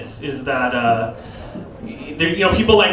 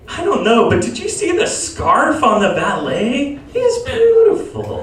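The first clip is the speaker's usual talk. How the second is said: high, drawn-out gush; a high mock swoon at the valet; swooning sister; 8 semitones higher, slower than usual. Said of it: "she's like".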